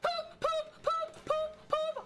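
very high, evenly spaced yelps; high panicked hooting. Real, not acted. Streamer fleeing the monster, hooting in panic. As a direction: hoot each word out high.

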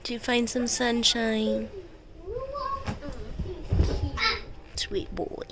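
slow, soft pet talk; cooing to the dog. Real, not acted. Mom softly praising her dog Crosby in the sun, slow and tender. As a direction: sweet-talk the dog gently.

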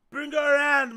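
loud hype. Real, not acted. Loud hyped demand.

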